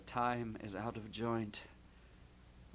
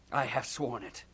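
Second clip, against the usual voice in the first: ordinary delivery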